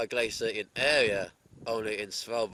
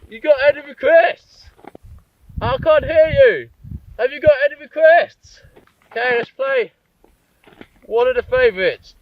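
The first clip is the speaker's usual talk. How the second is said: high, very loud stadium shout; rock star shouting to crowd; 17 dB louder than usual. Pretend. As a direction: yell it to a huge imaginary crowd. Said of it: "treat this like a microphone".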